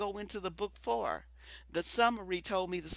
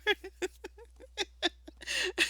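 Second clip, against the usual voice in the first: laughing; 13 semitones higher than usual. Real, not acted. Laughing at being scared.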